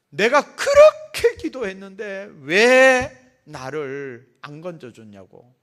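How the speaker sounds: loud, slow angry protest to God; huffing, accusing outcry; indignant complaint. Acted. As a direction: demand an answer, fuming. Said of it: "씩씩거리면서 하나님 앞에 가서 따졌는가".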